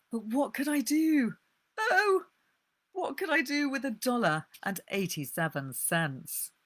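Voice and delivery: high anxious voice, loud cry on Oh; high despairing wail. Acted. Della's despairing self-talk.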